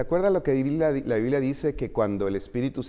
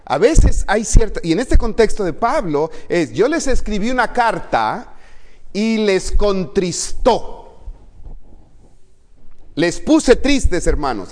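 Pablo hablando fuerte; 8 semitones higher, 8 dB louder than usual. Retold or imitated. Pablo citado con fuerza.

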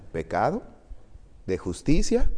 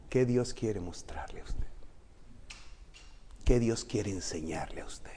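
whispered question; 3 semitones lower, 11 dB quieter than usual. Real, not acted. Pregunta íntima susurrada.

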